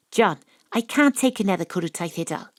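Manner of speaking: normal talking voice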